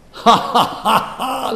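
loud, high "ha ha" laugh; imitated laughter at others' suffering; mocking laughter. Preacher imitating persecutors amused at human streetlights, mocking laughter. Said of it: "They thought that was funny".

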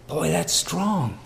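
ordinary delivery

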